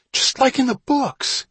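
very high, marvelling exclamation; thrilled wonder. A narrator's reading. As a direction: marvel at it like an excited boy. Awestruck boy exclaims over discovery.

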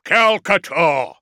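slow, high, booming war-machine call. A narrator's reading. Dreadnought calls out his enemy, booming. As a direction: boom the name out at full force. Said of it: "he boomed".

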